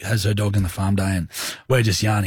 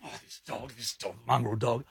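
cranky pacing mutter; 12 dB quieter than usual. Retold or imitated. Bitten man pacing by his ute, muttering crankily. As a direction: grumble under the breath, acted out. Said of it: "I was pacing like this".

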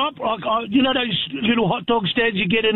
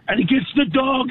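ordinary delivery

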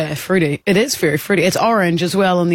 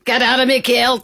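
light Scottish voice; Scottish accent; 5 semitones higher than usual. Pretend. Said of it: "we were doing Scottish accents before".

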